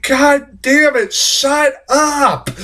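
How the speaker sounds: slow, high-pitched fed-up outburst; retold exasperation. Viewer fed up with the screaming character, exasperated.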